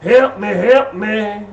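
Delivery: hammed-up wail for rescue; mock cry for help. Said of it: "crawling on the ground like".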